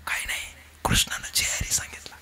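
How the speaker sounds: unvoiced secret whisper; whisper in the ear. Servant whispering Krishna's message into Balram's ear. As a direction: breathe it into his ear. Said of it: "कानात फक्त सांगायचं".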